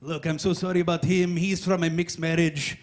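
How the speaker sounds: slowing, mock Croatian-accented apology; apologetic Croatian. Croatian apologizes for someone who left the room, in a mock accent. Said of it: "I heard a dozen people apologize".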